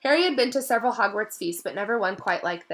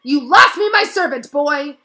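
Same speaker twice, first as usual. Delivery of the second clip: much higher, louder enraged yell; furious shout; 7 semitones higher, 13 dB louder than usual. A narrator's reading. Malfoy lunges at Harry, shouting in rage. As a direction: roar at the boy.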